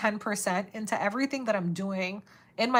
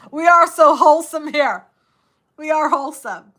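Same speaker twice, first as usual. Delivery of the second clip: excited; 7 semitones higher, 14 dB louder than usual. Real, not acted. Excited reply to chat.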